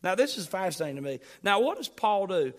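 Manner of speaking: ordinary talk, nothing marked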